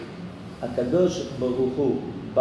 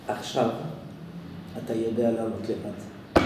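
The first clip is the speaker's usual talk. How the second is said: quiet father; 4 semitones lower, 7 dB quieter than usual. Retold or imitated. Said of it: "הוא אומר".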